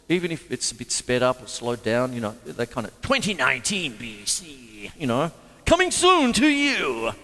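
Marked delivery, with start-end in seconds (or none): 3.0-7.1 mock movie-trailer announcer; lightly voiced trailer announcer; television voice. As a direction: play it like a TV promo read. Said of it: "the television voice".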